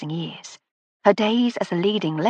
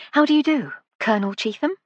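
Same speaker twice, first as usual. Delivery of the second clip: lady of the house, bright and gracious, higher; 7 semitones higher than usual. A narrator's reading. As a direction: greet guests like a delighted aristocrat. Lady Hardcastle greets.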